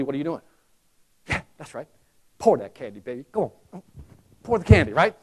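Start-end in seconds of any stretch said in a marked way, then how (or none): 1.3-3.8 low, coaxing inner voice urging them on; inner greedy voice. Retold or imitated. Shopper silently urging the clerk to pour, low coaxing. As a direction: egg the clerk on under your breath. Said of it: "But internally".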